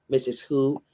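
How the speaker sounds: ordinary delivery, nothing marked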